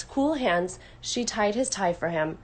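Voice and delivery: usual speaking voice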